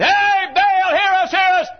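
very high, loud held wail. Acted. False prophets call on Baal to hear them, high loud wail. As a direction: cry out to a god at full pitch. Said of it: "Call a little louder".

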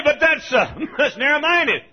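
high, loud mock protest; protesting objector. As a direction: mimic an indignant critic. Mimics objectors in the congregation.

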